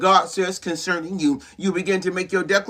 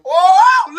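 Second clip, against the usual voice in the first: high, loud, long "oh"; big wordless shout; preacher's holler; 11 semitones higher, 9 dB louder than usual. Real, not acted. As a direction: cry out in sudden fervour. Cries out while preaching.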